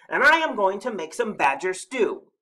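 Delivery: high, loud monster roar; roared threat. A narrator's reading. Snorklum telling Badger his stew plan, roaring. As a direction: roar out the menu fiercely. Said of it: "he roared".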